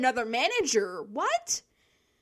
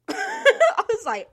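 laughing; 10 dB louder, much slower than usual. Real, not acted. Laughs while retelling.

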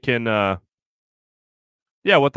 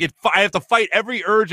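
ordinary talk

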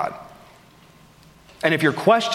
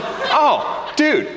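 high, whooping enthusiasm; excited approval; 11 semitones higher than usual. Retold or imitated. Doctor thinking the customer is buying pillows, excitedly approving. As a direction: gush with a big leap in pitch.

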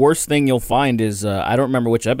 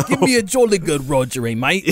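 put-on British accent; 5 semitones higher than usual.

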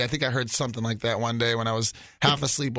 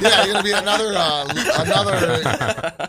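laughing; 5 semitones higher, 6 dB louder than usual. Real, not acted. Teasing about Russia talk.

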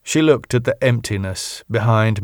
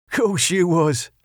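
sarcastic; 9 semitones higher than usual. Acted. Jimmy mocks Wayne's denial.

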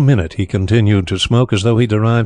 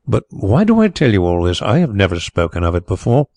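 usual speaking voice

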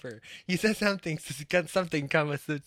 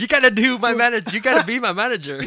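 fast, laughing and loud; 6 semitones higher, 6 dB louder, faster than usual. Real, not acted. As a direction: laugh the words out. Laughing while teasing friend.

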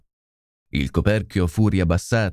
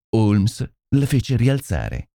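ordinary delivery, nothing marked

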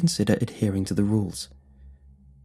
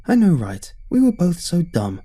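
put-on high valley girl voice; 8 semitones higher, 5 dB louder than usual. Thoyon quoted, high voice.

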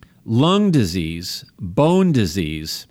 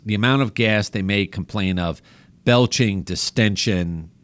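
usual speaking voice

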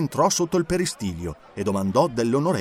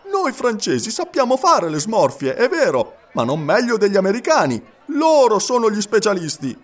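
higher and louder, defending his nation; irritated retort; 7 semitones higher, 5 dB louder than usual. A narrator's reading. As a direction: snap back, nettled. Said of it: "irritato di vedere considerata".

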